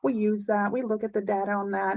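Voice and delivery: ordinary talk, nothing marked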